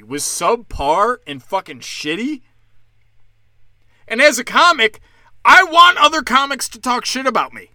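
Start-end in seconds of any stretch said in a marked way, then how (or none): 4.1-7.7 loud, raised ranting shout. Real, not acted. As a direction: yell it out, fired up. Angry comedy rant, shouting.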